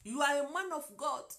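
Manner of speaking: put-on French-accented English said close to her own voice; simple French-accented English, almost plain; lightly accented English phrase; broken English. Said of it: "avec mon anglais bien déformé".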